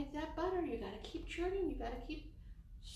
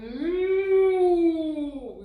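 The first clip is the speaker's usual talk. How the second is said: big, long, louder moo like a cow; 5 semitones higher, 15 dB louder than usual. Shown as a demonstration. Urging the shy child to moo, loud cow moo. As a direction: belt out a cow's moo. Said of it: "Go ahead".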